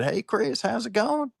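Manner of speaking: high-pitched Cajun neighbor impression; high, chirpy imitated greeting; Jody McGinnis. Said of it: "she's a full-on Cajun".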